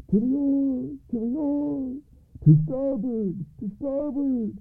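high, drawn-out ghostly chant; hollow ghostly call. A narrator's reading. Ghostly coxswain calls the fishermen to the oars, hollow and high. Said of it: "echoed the hollow voice of the coxswain".

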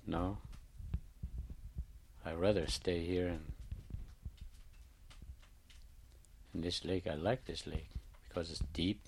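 lightly voiced fish, quietly refusing; fish answering softly.

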